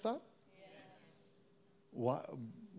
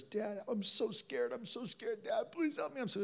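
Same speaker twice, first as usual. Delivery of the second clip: high, small pleading voice; imitated scared son; 9 semitones higher, 5 dB quieter than usual. Father imitates his son asking for help, scared and pleading. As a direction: plead softly like a frightened child. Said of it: "he goes".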